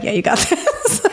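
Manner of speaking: self pep talk. Retold or imitated. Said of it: "I look at myself and I'm like".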